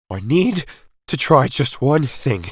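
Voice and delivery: a touch of desperate urgency. A narrator's reading. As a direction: push through in desperation. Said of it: "the doctor said in desperation".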